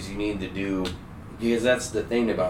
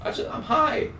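high, bright fake-innocent hello; excited stoned greeting; 12 semitones higher than usual. Stoned teen answering grandma's call, high and overexcited. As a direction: sound way too excited to be normal. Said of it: "you sound really excited and, like, energized".

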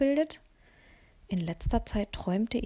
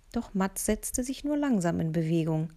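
ordinary delivery, nothing marked

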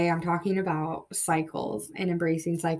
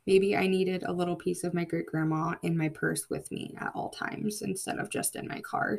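ordinary delivery

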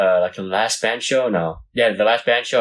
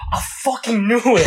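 triumphant gotcha; 10 semitones higher than usual. Retold or imitated. Friend's triumphant reply in story.

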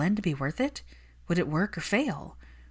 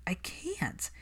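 a secret confessed under the breath; whispered admission; 2 semitones higher, 9 dB quieter than usual. Acted. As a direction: whisper it, barely voiced. Hermione admits she cannot.

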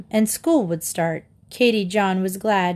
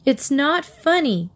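snapped; 6 semitones higher than usual. A narrator's reading. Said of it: "Katy snapped".

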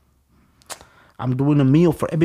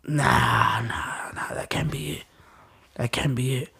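choked denial; 6 dB quieter, slower than usual. Retold or imitated. Denial on hearing brother died.

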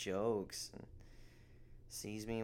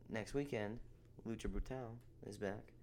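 normal talking voice

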